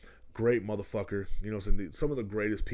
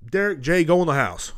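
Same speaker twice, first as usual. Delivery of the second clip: stern order inside; 8 semitones higher, 8 dB louder than usual. Retold or imitated. Adults ordering kids inside.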